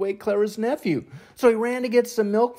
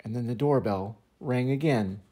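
usual speaking voice

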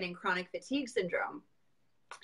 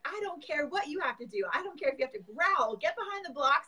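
high, pushy mock pep talk; pep-talking brother; 9 semitones higher, 4 dB louder than usual. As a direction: hype her up like a little brother. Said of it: "he's like".

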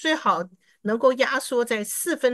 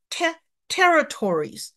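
slow practice try; 7 semitones higher, slower than usual. Shown as a demonstration. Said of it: "再试试看".

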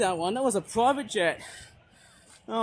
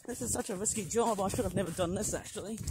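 ordinary talk

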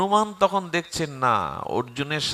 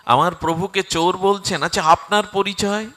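louder, indignant challenge; angry retort; 4 semitones higher, 5 dB louder than usual. Acted. Arjun defends his lord to Hanuman, angry retort. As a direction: demand an answer, offended for your lord. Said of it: "Arjun রেগে গিয়ে বলছেন".

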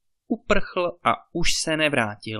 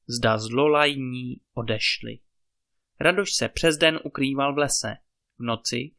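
ordinary delivery

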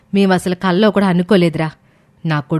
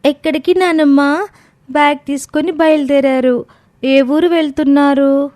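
put-on much higher, slow child voice; little grandson; 7 semitones higher than usual. Little grandson asks grandmother about the bags, high child voice. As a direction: ask innocently like a small boy. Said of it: "అది చూసిన చిన్న మనవడు".

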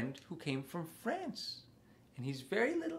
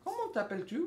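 high-pitched, soft spoken French question; 14 semitones higher, 5 dB quieter, much faster than usual. Pretend. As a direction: ask a little puppet its name in a high voice.

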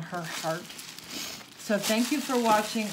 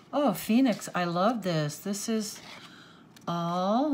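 usual speaking voice

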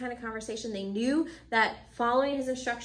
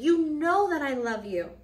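louder, higher, drawn-out insistence; insistent protest; 6 semitones higher, 6 dB louder than usual. Acted. Peter answers Jesus the second time, insistent. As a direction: stress it earnestly, slowly and loudly.

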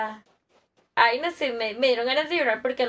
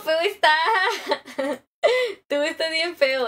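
teasing laugh; 3 semitones higher, slower than usual. Real, not acted. Teasing comment back, laughing.

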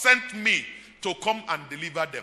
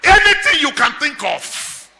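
loud, rising preacher's cry to the congregation; preaching at full force; 4 semitones higher, 11 dB louder than usual. Acted. Preacher proclaiming God's name to the church, at full force. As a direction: preach it out, driving every line home.

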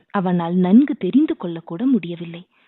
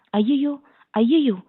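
mild, pitched-up fearful cry of ghost; terrified wail; 4 semitones higher than usual. A narrator's reading. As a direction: cry out as if you've seen a ghost, lightly voiced. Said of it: "என்று அலறிக்கொண்டு". (லேசான பயந்த அலறல்)

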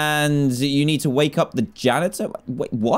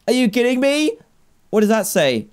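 ordinary talk, nothing marked

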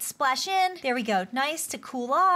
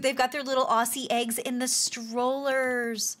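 ordinary talk

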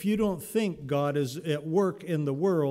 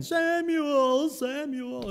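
high, ringing call of the name; voice of God calling; 12 semitones higher, 3 dB louder, much slower than usual. Pretend. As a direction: call out from far above.

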